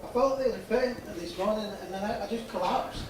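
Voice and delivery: a weak, unwell patient speaking; mannequin voice. Acted. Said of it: "I'll be actually the mannequin's voice today".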